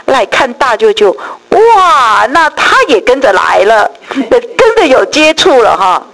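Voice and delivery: voicing the daughter's joy at the plan; excited daughter. Quoting the daughter's happy plan for the uncles, high and excited.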